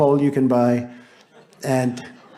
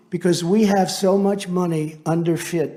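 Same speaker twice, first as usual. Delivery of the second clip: normal talking voice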